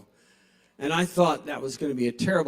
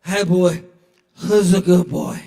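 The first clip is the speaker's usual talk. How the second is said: louder, gushing dog-praise voice; praising a dog; 2 semitones higher, 8 dB louder, slower than usual. Retold or imitated. Drunk bar patron gushing over a dog. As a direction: coo at a good boy, loudly.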